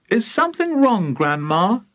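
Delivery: high-voiced polite question; polite asking. A narrator's reading. George to grandma frozen in mid-air, politely. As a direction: ask sweetly, starting high. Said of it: "George asked her politely".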